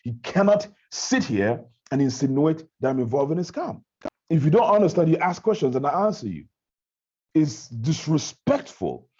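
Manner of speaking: retold heated confrontation. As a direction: insist on respect, emphatic and offended. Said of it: "it was as if everyone was heated up".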